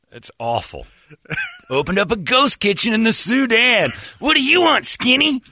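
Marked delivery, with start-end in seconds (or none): 1.7-5.4 high, loud brash celebrity chef. Pretend. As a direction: hype it like a TV chef. Mocking Guy Fieri pitch.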